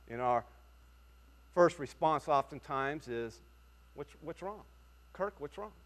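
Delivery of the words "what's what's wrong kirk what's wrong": gentle concern. Retold or imitated. Asking a downcast friend gently.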